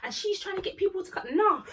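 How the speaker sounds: high, loud imitation of an outraged friend; indignant rant. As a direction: act out a friend's fed-up protest.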